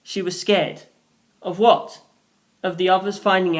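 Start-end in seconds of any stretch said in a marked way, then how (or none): none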